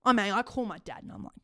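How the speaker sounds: usual speaking voice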